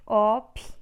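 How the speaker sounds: Ópto with a loud punched Óp; teacher stressing the first syllable. Shown as a demonstration. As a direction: hit the Óp of Ópto hard. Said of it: "A sílaba tônica é o op".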